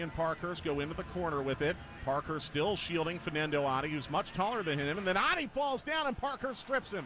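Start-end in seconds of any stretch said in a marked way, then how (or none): 5.0-7.1 sudden high burst on the fall; excited call. Real, not acted. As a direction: spike the voice at the tackle. Sudden turnover.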